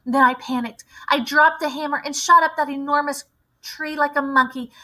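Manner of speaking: usual speaking voice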